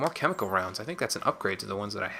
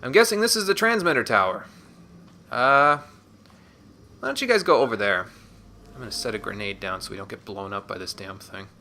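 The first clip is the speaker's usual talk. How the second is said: ordinary delivery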